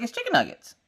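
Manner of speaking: high-pitched, emphatic teasing; mock insistence. Mom insisting ground beef is nuggets, high mock insistence.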